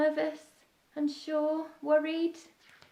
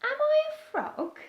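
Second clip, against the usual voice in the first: Beaky's voice; 5 dB louder than usual. Acted. Beaky voiced in read-aloud story.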